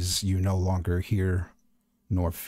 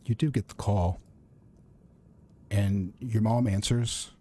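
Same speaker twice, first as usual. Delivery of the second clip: ordinary delivery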